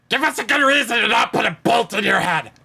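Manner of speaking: fast shouted threat in character; Mange's threat. Acted. Mange threatening the guy with a bolt, shouted in character. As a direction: rattle it out as a rough warning. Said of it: "Mange turns and looks back at the guy".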